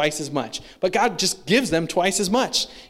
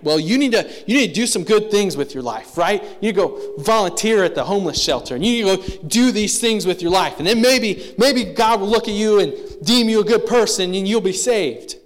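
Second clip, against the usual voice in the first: louder, higher mock preacher pushing good works; mocking the wrong view; 4 semitones higher, 5 dB louder than usual. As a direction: mimic the self-righteous checklist, rising toward the end. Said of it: "we try to, like, flip it upside down".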